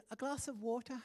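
wife's crisp British order, lightly imitated; British accent. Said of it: "she'll, like, she'll order".

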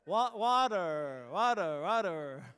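"water" repeated with flapped t and strong r, slowing down; American accent. Shown as a demonstration. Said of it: "you've gotta communicate".

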